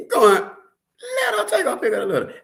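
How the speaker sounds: high, laughing mock judge. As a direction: tease it out like a cackling judge.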